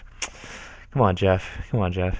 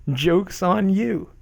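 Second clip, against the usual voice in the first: mocking; 8 semitones higher, much slower than usual. Mocking read of forum post.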